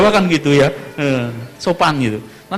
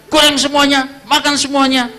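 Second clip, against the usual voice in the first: higher, louder mock order; joking command; 10 semitones higher, 5 dB louder than usual. Husband jokingly tells wife to fry it all, loud. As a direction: declare it with a grin.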